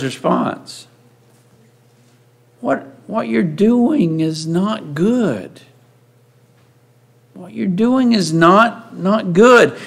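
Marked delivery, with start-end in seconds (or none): 2.6-9.8 slow, deliberate reproof, weighing each word; measured, drawn-out scolding; grave admonishment. Acted. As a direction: admonish slowly with firm stresses. Jethro's rebuke of Moses, acted.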